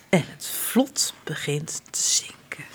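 ordinary delivery, nothing marked

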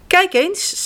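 put-on a bright child showing off her doll; Janneke voice. A narrator's reading. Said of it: "zegt Janneke". (hoog meisjesstemmetje)

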